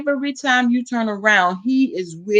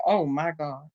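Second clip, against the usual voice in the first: much softer and lower; hushed stunned oh my God; 5 semitones lower, 9 dB quieter than usual. Retold or imitated. At lunch hearing the news, hushed and low. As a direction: sink down into a hushed gasp of disbelief.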